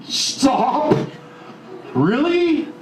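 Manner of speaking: high, sharp retold protest of Stop; a speaker replays his annoyed reaction to his partner's advice, sharp and high; indignant stop. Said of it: "That's what she told me, and I was pissed off".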